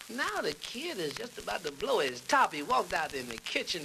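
normal talking voice

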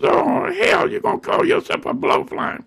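high mock grandma; grandmother's voice. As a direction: squeal it like the grandmother. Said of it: "She said".

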